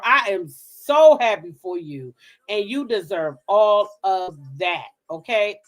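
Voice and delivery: normal talking voice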